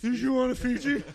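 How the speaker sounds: very high mock-helpful offer; eager assistant. PA offering bottled water on set, high mock innocence. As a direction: play a caught helper, voice pushed way up.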